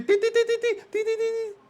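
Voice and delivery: high-pitched imitated beeps of a heart-rate alarm; watch beeping. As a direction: chirp like a smartwatch alarm. (pitidos agudos de reloj)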